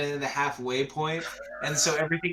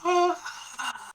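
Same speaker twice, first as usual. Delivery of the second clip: high dying gasp, fading away; last gasp. Acted. Game master acts Fruby's last gasp after the slice, high gasp. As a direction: gasp sharply and let it die out. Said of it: "the last gasp is like".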